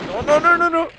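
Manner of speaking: high, loud string of alarm; panicked. Real, not acted. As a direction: blurt it out as the fight goes wrong. Losing health in boss fight. (pánico en plena pelea)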